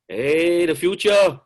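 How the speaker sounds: excited call. Real, not acted. Calling out a player's name.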